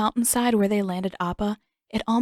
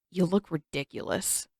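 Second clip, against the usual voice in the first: low grumble, softer; sulky grumble at the disguise; grumbling; 3 semitones lower, 6 dB quieter than usual. A narrator's reading. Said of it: "Sokka grumbled".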